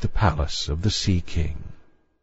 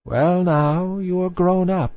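put-on high, slow old lady voice; old grandmother voice; 9 semitones higher than usual. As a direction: speak slowly like an old grandmother. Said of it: "said the old dowager, her grandmother".

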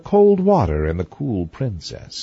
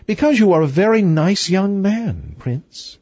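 light, higher princess voice; cartoon princess; 8 semitones higher than usual. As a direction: flirt as a playful princess. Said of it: "said the princess".

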